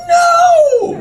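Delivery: high, loud screech; outraged refusal. Retold or imitated. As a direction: scream it as an indignant woman. Woman angrily refuses.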